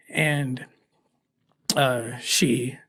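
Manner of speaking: emotion catching in the throat, words falling away; choked up. Real, not acted. Recalling the little girl, voice breaking with real emotion. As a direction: let the memory stop you mid-sentence, softly. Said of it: "why I'm getting so emotional".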